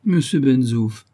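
slow, humble plea, a touch of the beggar; humble pleading. Acted. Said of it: "avec un humble accent de supplication". (supplier humblement, lentement)

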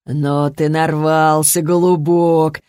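slow thug threat with a grin; Колян. A narrator's reading. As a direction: drawl the threat, smirking. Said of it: "щербато ухмыльнулся Колян". (тягучая угроза с ухмылкой)

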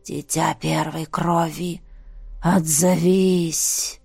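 low, slow eerie summoning voice; низкий зловещий зов. A narrator's reading. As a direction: call out slowly like a ghostly voice. Зов голосов во сне. (низкий, медленный потусторонний голос)

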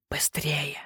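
urgent breathy hiss into an ear; hissed whisper. Acted. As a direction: hiss it so only she hears. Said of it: "прошипел король Веренс".

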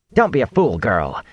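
put-on an irritated woman snapping at a girl; snapped rebuke. A narrator's reading. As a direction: cut her off like a stern village elder. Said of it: "Nynaeve snapped".

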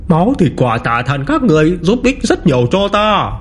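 high, eerie monster gloating. Acted. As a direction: crow over it like a ravenous beast. Hoàng Yêu gloats over growth.

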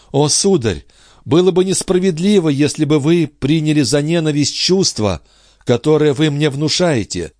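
Andrée's anguished voice. A narrator's reading. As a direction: lament it, lifted and pleading. Andrée answering Charny.

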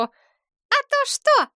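very high, drawn-out defiant squeal; shriek. A narrator's reading. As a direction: shriek the challenge back at him. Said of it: "Девица взвизгнула". (визгливо, с вызовом)

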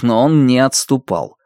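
plain reading voice

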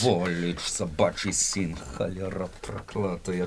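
low, soft string of curses; muttered curses. Acted. Soldier cursing the missing woman's lover, low and muttered. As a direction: grumble the insults under your breath.

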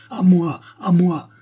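high, loud triumphant claim; crying out. A narrator's reading. Treadle claiming the tin box he dug up, crying out. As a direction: seize the prize with your voice. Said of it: "s'écria-t-il en la posant".

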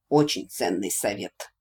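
low, sarcastic deadpan; dry, caustic mock praise; venomous sarcasm. A narrator's reading. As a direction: drop the pitch and remark acidly. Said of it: "ядовито заметил Василиск".

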